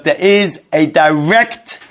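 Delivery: slow down and weigh the word direct; emphasizing direct. Shown as a demonstration. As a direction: direct drawn out slowly and leaned on. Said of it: "I'm emphasizing the word direct".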